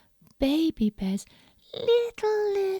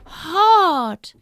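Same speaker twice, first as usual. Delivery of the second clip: high, loud, slow complaint; high loud girl voice, drawn out; Goldilocks complaining; 12 semitones higher, 10 dB louder, slower than usual. Acted. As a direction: whine that the bed is too hard. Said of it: "She said".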